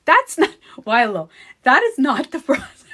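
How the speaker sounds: high, loud laughing objection; laughing protest. Real, not acted. She laughs at a viewer's comment, protesting loudly.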